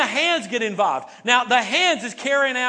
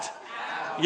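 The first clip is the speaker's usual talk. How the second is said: whispered pout; 10 dB quieter than usual. Shown as a demonstration. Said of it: "Everybody say pout".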